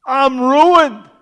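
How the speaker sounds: loud, slow anguished cry; loud slow cry of woe. Acted. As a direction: cry out in despair. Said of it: "he cries out these words".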